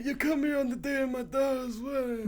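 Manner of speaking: lightly voiced old mob boss; old man voice. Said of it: "That's just my old man voice".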